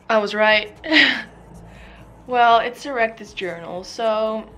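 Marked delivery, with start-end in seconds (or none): 0.8-2.2 loud burst of laughter; laughing. Real, not acted. Laughing at the mess.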